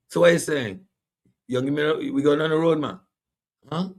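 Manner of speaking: high street voice asking; slangy local caller. Retold or imitated. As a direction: toss it off like a local guy. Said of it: "they come and say".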